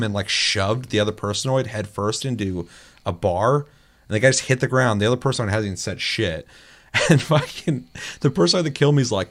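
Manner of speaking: ordinary delivery, nothing marked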